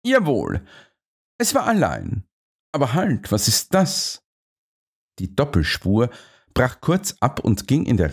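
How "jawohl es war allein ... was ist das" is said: a higher, slightly brisk Holmes voice; a hint of a keen detective; lightly voiced Holmes. A narrator's reading. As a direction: read Holmes a touch higher than the narration.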